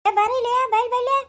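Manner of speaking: high, loud call across the yard. Acted. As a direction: call her over from a distance. Mother calls daughter over.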